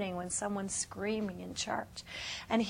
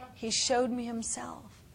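usual speaking voice

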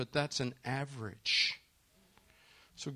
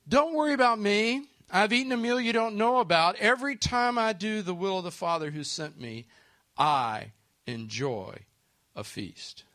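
fervent proclamation; 7 semitones higher, 7 dB louder than usual. Shown as a demonstration. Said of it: "I love it".

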